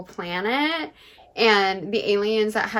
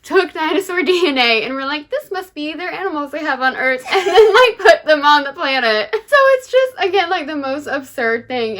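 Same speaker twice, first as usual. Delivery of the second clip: excited laughing; 6 semitones higher, 9 dB louder than usual. Real, not acted. Laughing at absurd book plot.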